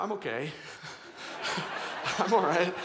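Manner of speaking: breaking into real laughter while insisting he's fine; laughing it off. Real, not acted. Dad laughing off his daughter pushing his hand away.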